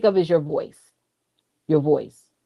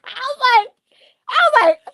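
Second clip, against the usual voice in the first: high squeaks between laughs; helpless laughter. Real, not acted. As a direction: try to talk while cracking up. Too tickled to continue the story.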